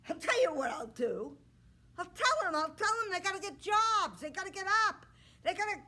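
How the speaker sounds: a cocky prince showing off; boastful suitor. Acted. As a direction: puff up like a vain young man.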